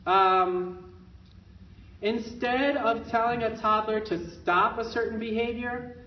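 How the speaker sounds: ordinary delivery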